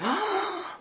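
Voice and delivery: sharp high gasp of disbelief; shocked gasp. Retold or imitated. Schoolgirl on the bus, shocked at Eli, gasps. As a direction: gasp like it's unthinkable. Girl shocked he doesn't know.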